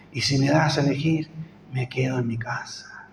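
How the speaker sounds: weary inner voice, whispered; whispered thought. Retold or imitated. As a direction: let the tired thought slip out under the breath. Tired thought on night road.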